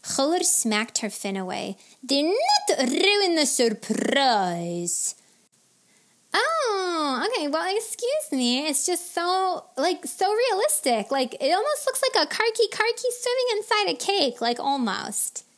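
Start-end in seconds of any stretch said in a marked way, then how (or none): 2.0-5.2 slow, loud, higher-pitched order; stern, stretched-out telling-off; scolding. A narrator's reading. Smacks fin, scolds the angel fisher. 6.3-15.4 high, gushing character voice; high voice, dawdling then rattling on; chatty angel fisher, affronted then awed. A narrator's reading.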